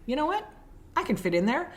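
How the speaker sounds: high, defiant "You know what?"; decide to take the spot; determined resolve. Retold or imitated. Woman deciding to ram her car in, defiant and high. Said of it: "she's like".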